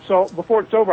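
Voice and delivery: ordinary delivery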